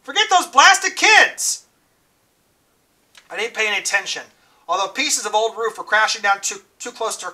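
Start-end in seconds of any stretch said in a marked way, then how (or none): 0.0-1.6 high frantic yell across the fire; screaming. Acted. Dally screaming at Ponyboy to leave the burning church, high and loud. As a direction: yell at him to get out, voice climbing.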